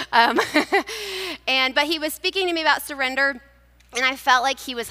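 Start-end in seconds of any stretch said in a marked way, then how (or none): 0.4-1.4 sheepish laugh. Real, not acted. Laughing at her own admission.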